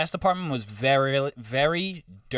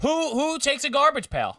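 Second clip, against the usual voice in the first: mock outrage; 12 semitones higher than usual. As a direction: play up the outrage. Mock outrage about roommate.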